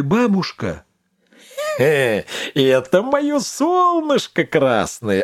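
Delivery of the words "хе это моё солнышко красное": put-on slow old witch voice; Baba Yaga. A narrator's reading. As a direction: croak it like a crafty old witch. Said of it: "отвечала Баба Яга".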